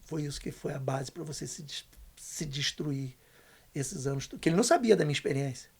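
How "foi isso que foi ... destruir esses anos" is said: father's soft confession. Retold or imitated. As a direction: quietly voice the father asking forgiveness. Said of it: "ele olhou dentro do meu olho e falou assim". (confissão baixa do pai, em voz contida)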